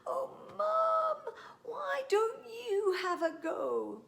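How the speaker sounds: high, pleading whine; begging. Acted. Child begging mum to pull the prickles herself, high and pleading. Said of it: "I begged her twice".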